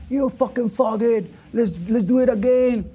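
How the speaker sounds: loud, high, slow insulting challenge; retold challenge, loud and high; angry taunt. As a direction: sneer and challenge him to go again. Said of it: "I told me".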